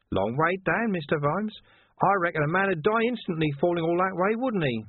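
high, sly taunting voice; taunting villain. A narrator's reading. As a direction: menace him playfully.

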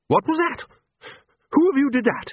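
very high, indignant questioning; high-pitched alarmed outburst; startled demand. A narrator's reading. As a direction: demand an answer in a shocked, high voice. Startled character demands who did it.

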